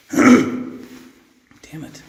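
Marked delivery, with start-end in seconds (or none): 0.1-0.9 throat clearing. Real, not acted. Coughing fit in the cell.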